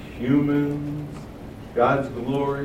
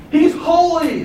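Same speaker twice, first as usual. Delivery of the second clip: high, loud cry of holiness; exclaimed praise, raised and loud; emphatic proclamation; 12 semitones higher, 5 dB louder, slower than usual. Acted. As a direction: call it out with force, far above talking pitch. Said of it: "They're emphasizing the nature of God".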